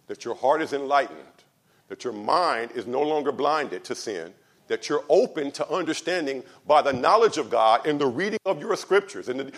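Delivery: normal talking voice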